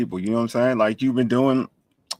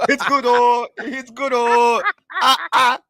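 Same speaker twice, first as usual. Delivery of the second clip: mock Nigerian accent, pitched high; 10 semitones higher, 6 dB louder than usual. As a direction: join in the Nigerian bit. Joins friend's Nigerian accent bit.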